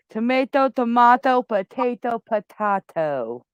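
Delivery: "tomato" with "ay" then "ah", "potato" with "ay" then "ah"; tomato potato variants. Shown as a demonstration. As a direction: switch the vowel: "tomato" "ay" then "ah", and the same for "potato". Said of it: "It's the same fucking thing".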